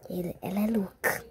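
unvoiced whispered aside; hushed whisper. Real, not acted. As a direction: whisper it behind her back.

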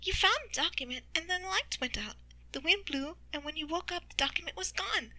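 high-pitched voice piecing events together; Skitter. Acted. Character speaks in narrated story.

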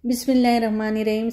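ordinary talk, nothing marked

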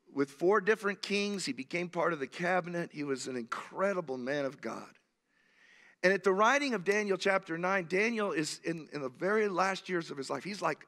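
ordinary talk, nothing marked

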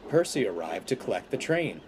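plain reading voice

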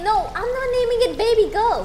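high, loud protest; indignant refusal. Real, not acted. Streamer refusing viewers' name for the cat, high loud protest. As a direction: push back loudly at the suggestion.

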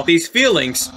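usual speaking voice

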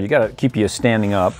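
ordinary talk

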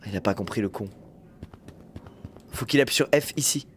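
ordinary talk

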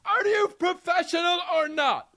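very high mock-angry yell. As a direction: imitate a furious actor in a high, stretched voice. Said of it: "went for the jugular".

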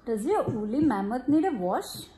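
gentle storybook reading, easy pace; reading to children. Acted. Mother reading a bath-time picture book to her child, gentle storybook voice. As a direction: read it aloud to a little one.

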